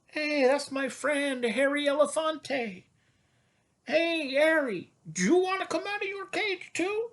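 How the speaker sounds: high, excited gorilla calling to a friend; high playful gorilla voice. Pretend. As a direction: squeak it out to the elephant. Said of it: "he says".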